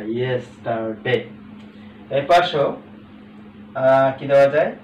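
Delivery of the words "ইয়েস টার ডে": "ইয়েস, টার, ডে" slowly, piece by piece; slow, separated "ইয়েস, টার, ডে"; split into syllables. Shown as a demonstration. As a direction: give each of "ইয়েস, টার, ডে" its own beat. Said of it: "ভাগ ভাগ করে বলতে পারছি".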